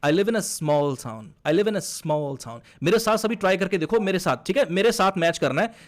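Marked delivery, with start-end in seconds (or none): 0.0-2.6 slow linked delivery, "small" stressed; "I live in a small town" in one flow, "small" highlighted. Shown as a demonstration. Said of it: "इसको बोलते हैं flow". (Flow में, small पर ज़ोर)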